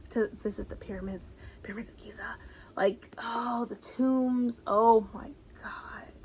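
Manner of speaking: soft whispered longing; dreamy whisper. Real, not acted. Whispered longing about Egypt.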